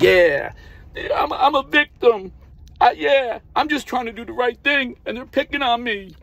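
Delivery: very high, whiny mock victim voice; mock a self-pitying complainer in a squeaky high pitch; weaker man playing victim. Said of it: "like so many weaker men".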